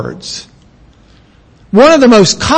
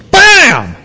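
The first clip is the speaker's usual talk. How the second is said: high, louder punchy Bam!; a chef's spice shout; emphatic Bam; 10 semitones higher, 4 dB louder than usual. Shown as a demonstration. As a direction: slam the word down. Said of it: "he wants emphasis there".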